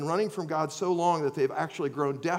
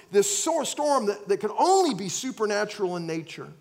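louder, higher preaching at full force; fervent preaching; 7 semitones higher, 5 dB louder than usual. Acted. Preacher to congregation painting the storm, fervent full-force preaching. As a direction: pour it on like a revival preacher.

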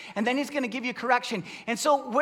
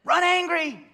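coach command; 7 semitones higher, 5 dB louder than usual. Retold or imitated.

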